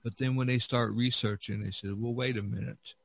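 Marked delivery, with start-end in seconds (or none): none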